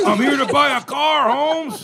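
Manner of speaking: mock tough guy. Mocking a friend's tough talk.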